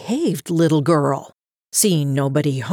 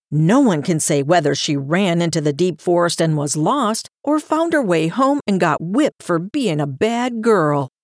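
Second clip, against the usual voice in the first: plain reading voice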